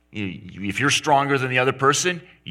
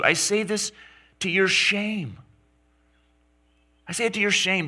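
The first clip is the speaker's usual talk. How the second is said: higher, pointed scolding of the church; indignant rebuke; 8 semitones higher than usual. Retold or imitated. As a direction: shame them, voice raised high. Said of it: "Paul's absolutely indignant".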